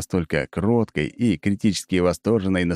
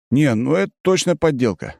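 scoffing; 7 semitones higher than usual. A narrator's reading. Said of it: "фыркаю я".

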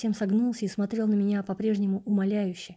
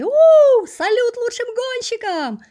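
long high cheering yell, much louder; crowd cheer; 10 dB louder, much slower than usual. Acted. Spectators hail the best racers with a slow, loud cheer. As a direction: cheer it out over the stadium.

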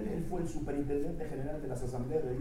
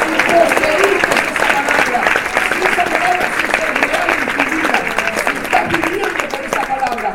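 loud, higher, driving sermon delivery; full-force preaching; 7 semitones higher, 15 dB louder than usual. Real, not acted. Pastor preaching loudly to the church about holding God's word.

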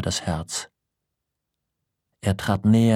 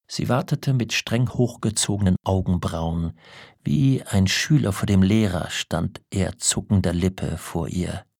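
usual speaking voice

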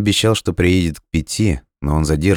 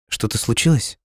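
higher-pitched, worried question; anxious; 7 semitones higher than usual. A narrator's reading. Girl asking Dima after the phone call, anxious. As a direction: ask in alarm, pitch rising at the end. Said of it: "в тревоге спросила девушка".

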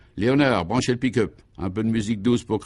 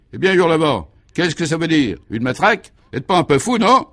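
louder and higher, building to a near-shout on non; outraged scolding; 7 semitones higher, 6 dB louder than usual. Acted. Commissaire berating Hurlevent over the truncheon, outraged and near-shouting. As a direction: blow up at him, with the last word shot up high.

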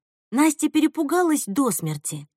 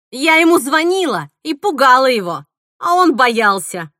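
loud, triumphant boasting; sudden gloating glee; 3 semitones higher, 7 dB louder than usual. A narrator's reading. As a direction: gloat over scaring him. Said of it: "Мила вдруг засмеялась". (злорадно и громко)

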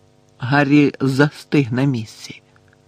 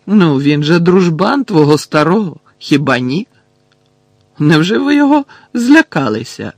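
loud, high-pitched mock surprise; mocking taunt; 4 semitones higher, 7 dB louder than usual. A narrator's reading. Harry taunts frightened Malfoy, mocking. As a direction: needle them with exaggerated disbelief.

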